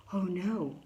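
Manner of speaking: usual speaking voice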